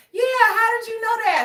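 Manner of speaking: high, fast, astonished quoted guy; imitating a stunned security guard; surprised coworker. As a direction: squeak it out amazed. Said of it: "And he was like".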